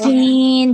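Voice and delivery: سین with a long, drawn-out whistling hiss; whistled seen. Shown as a demonstration. Teacher drawing out a whistled سین for the student. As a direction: stretch the hiss of سین out slowly. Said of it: "جتنی اچھی سیٹی کی آواز ہوگی".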